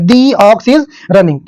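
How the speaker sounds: slow demo with "the" said as long "dee" before ox; dee before vowel. Shown as a demonstration. Teacher demonstrating to class: "the" as "dee", slowly. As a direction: stretch "the" into "dee" and read slowly. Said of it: "इससे पहले दी बोलते हैं".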